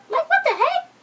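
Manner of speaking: outraged disbelief. Retold or imitated. Reacting to the show's betrayal, high outraged disbelief. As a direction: shriek it in mock outrage. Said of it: "And I'm like".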